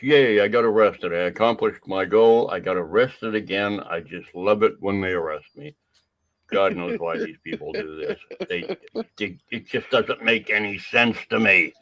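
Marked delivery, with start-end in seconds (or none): 0.0-5.7 gloating cheer, loud at first, then easing; sarcastic celebration in another man's words; mock glee. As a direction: imitate someone thrilled with himself. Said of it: "In his words".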